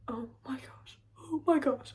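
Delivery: whispered, breathless oh my gosh; hushed panicked whisper; frightened whisper. Retold or imitated. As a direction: whisper it in terror. Terrified on the ride.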